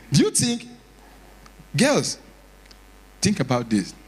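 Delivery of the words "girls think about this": ordinary delivery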